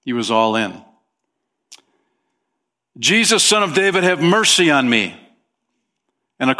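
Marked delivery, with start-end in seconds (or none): 3.0-5.2 higher, full-voiced cry of mercy; shouted plea. Shown as a demonstration. Preacher acting out Bartimaeus calling to Jesus, shouting. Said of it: "No, he shouted".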